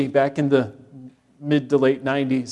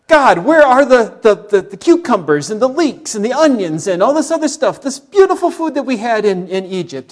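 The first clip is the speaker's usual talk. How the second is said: grumbling complaint; 6 semitones higher, 5 dB louder than usual. Retold or imitated. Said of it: "What are they grumbling about?".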